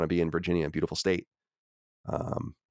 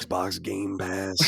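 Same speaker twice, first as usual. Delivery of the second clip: slow, low, soft Southern drawl on "Xbox Game Pass"; mock Southern cowboy; 3 semitones lower, 4 dB quieter, much slower than usual. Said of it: "Red Dead voices".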